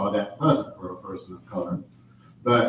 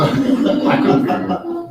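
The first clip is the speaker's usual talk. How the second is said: laughing; 9 dB louder, slower than usual. Real, not acted. Laughing at own childhood confusion.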